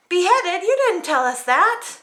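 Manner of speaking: startled protest; alarmed princess. Acted. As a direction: gasp at the news. Paulina alarmed.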